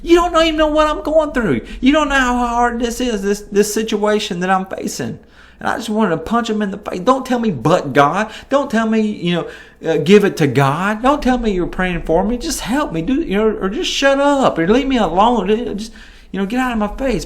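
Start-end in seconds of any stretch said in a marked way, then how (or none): 0.0-5.2 higher, fast frustrated outburst; mock angry retort. Pastor retells snapping at well-meaning friends, exasperated. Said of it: "It would make me so mad". 7.0-17.2 higher, irritated pleading; exasperated rant. Retold or imitated. Preacher vents at people who say give it to God, exasperated.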